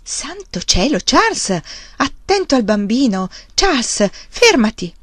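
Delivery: high, urgent exclamations; alarmed protest. A narrator's reading. Wife protests, alarmed for baby.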